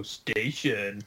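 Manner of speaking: low, drawn-out background vocal; understated low murmur held long. Retold or imitated. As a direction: lightly imitate a quiet backing singer, slowly. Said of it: "[name] very quietly in the background".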